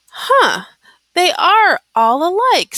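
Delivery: loudly surprised huh; surprised exclaim. A narrator's reading.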